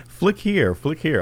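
high-pitched imitation of app instructions; put-on high voice of a dumb app; mock app prompt. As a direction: chirp it high like a silly tutorial. Voicing the app's instructions.

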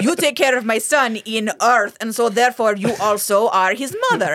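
hammed-up Russian mother; Russian accent. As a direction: put on a mock Russian accent, half laughing. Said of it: "She should have had a Russian accent".

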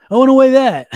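put-on high, loud childish whine; mocking stubborn client. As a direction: mimic a client who won't listen, up high and loud.

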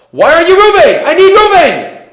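high, loud frustrated shout of "Reuben"; mock screaming. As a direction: yell it like you've lost patience searching the crowd. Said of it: "Starts screaming at them".

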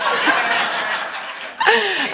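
long high cackle. Real, not acted. Speaker tickled by calling herself hilarious, long cackle. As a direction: keep cackling, high and helpless. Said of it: "give it up for my cackle".

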